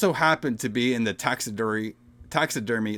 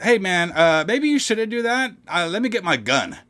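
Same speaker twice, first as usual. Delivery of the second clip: mock cautious guy; 6 semitones higher than usual. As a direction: lightly warn him off in a put-on voice. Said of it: "Nat was like".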